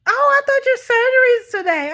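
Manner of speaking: high, loud puzzlement; confused caller. Retold or imitated. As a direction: imitate a baffled woman on the phone. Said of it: "She was confused".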